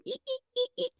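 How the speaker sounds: high thin squeaks imitating a creaking stair; mock stair creaks, very high and soft; creaky stair squeak. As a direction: squeak like old wooden steps under weight. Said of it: "and the, uh, stair go".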